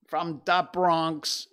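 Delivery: hammed-up, stretched-out "da Bronx"; Bronx accent. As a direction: play it loud as a Bronx local. Showing off Bronx shirt, hammed accent.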